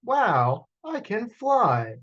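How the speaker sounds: very high, slow, thrilled cry; excited shout. Acted. Young dragon cries out with delight, very high. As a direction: sing out the joy of flying. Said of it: "Dom shouted".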